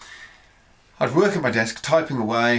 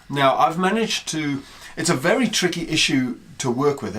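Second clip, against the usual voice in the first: ordinary talk, nothing marked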